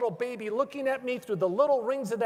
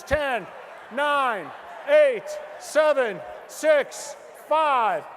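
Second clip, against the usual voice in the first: loud countdown, the offer running out; shouted countdown; 3 semitones higher, 6 dB louder, much slower than usual. Acted. Shouted countdown selling twenty.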